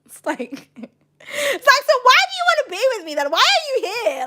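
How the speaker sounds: high, laughing mock plea; laughing while quoting. Streamer retelling an old argument, high and laughing. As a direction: squeak the quote through laughter. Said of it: "Do you know I'm laughing?".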